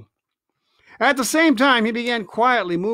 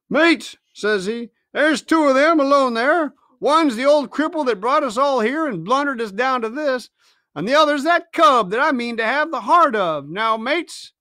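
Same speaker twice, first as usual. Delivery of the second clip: rousing pirate; 5 semitones higher than usual. Acted. Mutineer rallying the crew to attack. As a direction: whip the crew up to charge.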